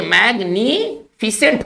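"मैग्निफिसेंट" ending in one syllable, fi-sent; correct pronunciation. Shown as a demonstration. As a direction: say "मैग्निफिसेंट" as mag-ni, then fi-sent, no extra i. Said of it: "इसका सही उच्चारण होना चाहिए". ("मैग्निफिसेंट" का सही उच्चारण, फि-सेंट)

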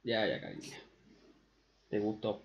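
suave y bajito, condescendiente; soft soothing. Real, not acted. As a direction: calma a la novia con voz bajita. Soothing girlfriend mockingly. (le habla a su novia con dulzura burlona)

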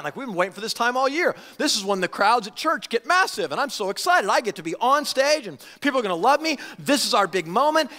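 high, eager and fast, hungry for the spotlight; mock the leaders' giddy self-importance; gleeful excitement. Leaders imagining their stage moment, hammed-up excitement. Party leaders gloat before Passover crowds.

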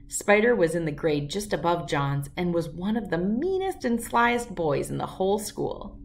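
usual speaking voice

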